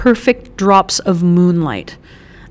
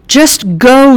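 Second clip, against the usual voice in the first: higher, louder urgent shout; yelled; 6 semitones higher, 5 dB louder than usual. A narrator's reading. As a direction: shout it at them to run. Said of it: "Jules yelled".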